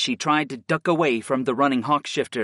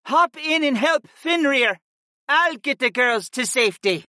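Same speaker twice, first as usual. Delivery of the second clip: high, raised battle cry; urgent call; 11 semitones higher than usual. A narrator's reading. Older hawk woman to Griff mid-battle, calling urgently.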